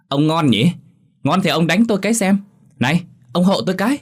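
high, quick jeering; acted derisive daughter; mocking taunt. A narrator's reading. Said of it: "gương mặt giễu cợt".

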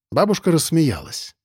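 plain reading voice

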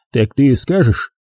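usual speaking voice

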